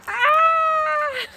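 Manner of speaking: long high scream of surprise; high, loud squeal. Real, not acted. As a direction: shriek as the egg bursts. Egg bursts on her head.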